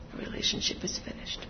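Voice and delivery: unvoiced whisper of the words. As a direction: let it fade to a whisper. Repeated, whispered.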